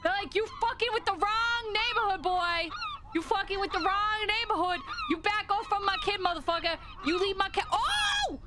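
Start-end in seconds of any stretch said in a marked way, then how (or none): 0.3-7.6 high, mock-outraged threats; angry parent gull. Viewer voicing the parent gull, mock fury. Voices the gull defending its kid. 7.7-8.4 very high, alarmed wail; distressed squeal. Real, not acted. Viewer watching the chick attacked, distressed squeal.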